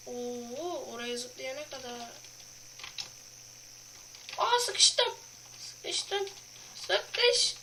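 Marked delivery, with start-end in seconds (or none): 4.4-7.5 high startled cry; alarmed. Real, not acted. Gamer crying out on getting stuck.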